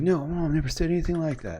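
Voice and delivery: high-pitched retold protest; mildly acted, disbelieving denial; startled denial. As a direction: imitate someone flustered, insisting it's untrue. Retold man denies what aunt said.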